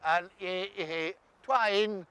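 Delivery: usual speaking voice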